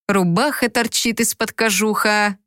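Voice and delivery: plain reading voice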